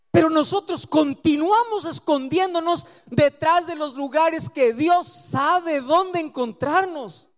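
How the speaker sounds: normal talking voice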